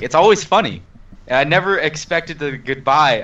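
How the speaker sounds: usual speaking voice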